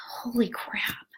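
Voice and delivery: breathless whispered amazement; slow unvoiced whisper of awe; awed whisper. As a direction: whisper it, stunned. Awed reaction after reading.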